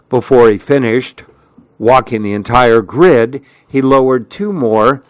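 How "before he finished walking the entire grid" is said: ordinary delivery, nothing marked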